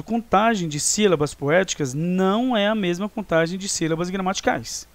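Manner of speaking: usual speaking voice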